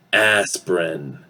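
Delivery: ASPIRIN in two syllables, AS-prin; wide ah, then -sprin in ASPIRIN; reduced vowel. Shown as a demonstration. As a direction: say ASPIRIN without the middle vowel. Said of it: "going to pronounce this as".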